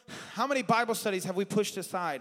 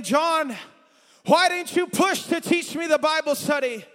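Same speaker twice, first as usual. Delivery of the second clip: higher, louder pleading cry; voice of the lost crying; 8 semitones higher, 6 dB louder than usual. Retold or imitated. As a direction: plead out as the burning souls. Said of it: "I hear the sounds of people crying my name".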